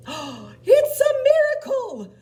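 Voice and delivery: very high, loud, drawn-out marvelling cry; amazed shout. Acted. Animals watch Gerald dance, shouting amazed. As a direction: shout it in astonished delight. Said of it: "They shouted".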